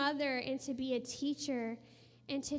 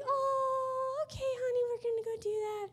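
excited mom reply; 12 semitones higher than usual. Retold or imitated. Mom agreeing to her daughter's idea, high-pitched excitement. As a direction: play an eager mother humoring her child.

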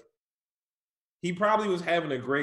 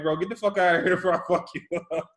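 retold fast threat; 3 semitones higher, faster than usual. And I was like.

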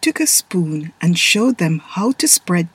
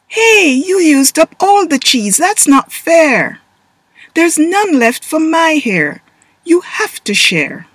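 indignant complaint; 9 semitones higher, 5 dB louder than usual. Acted. Boy complaining about cheese.

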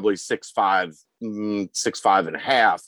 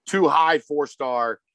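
usual speaking voice

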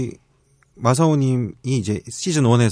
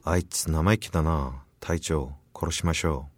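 quiet menace; 6 semitones lower, 8 dB quieter, slower than usual. Acted. 부하 외계인 속삭임.

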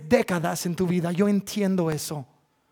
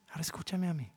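quiet firm; 2 semitones lower, 12 dB quieter, much faster than usual. Acted. Pastor repeating God's request, even softer. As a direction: let it settle, barely raised.

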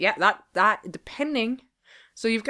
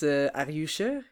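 suspicious asking; 3 semitones lower than usual. Shown as a demonstration. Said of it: "What if I ask you like this?".

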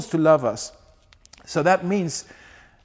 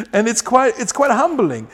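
laughing emphasis; 6 semitones higher, 6 dB louder than usual. Real, not acted.

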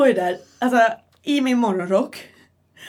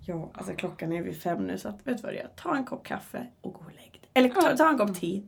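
very soft, half-whispered advice; retold quiet, tactful officer; imitated hushed policeman; 4 semitones lower, 7 dB quieter than usual. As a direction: gently send someone off to bed, under the breath. Imitates policeman gently sending her to bed.

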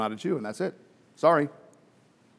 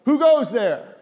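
called out high and loud like a sentry; guard's challenge; 6 semitones higher, 6 dB louder, slower than usual. Retold or imitated. Guard calling to a stranger at dawn, loud challenge.

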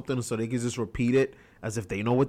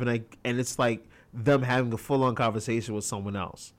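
ordinary talk, nothing marked

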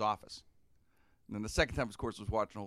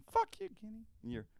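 each curse pitched higher, rising to a squeak; voices climbing higher; 14 semitones higher than usual. Retold or imitated. Two friends swearing at each other, pitch rising with every line. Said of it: "it gets higher and higher".

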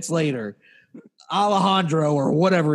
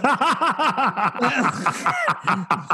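long high peal of laughter; real laughter; 8 semitones higher than usual. Real, not acted. Storyteller cracking up at his own anecdote, real laughter. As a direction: burst out laughing helplessly.

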